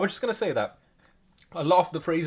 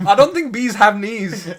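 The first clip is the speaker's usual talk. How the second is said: laughing disbelief; 6 semitones higher, 8 dB louder than usual. Real, not acted.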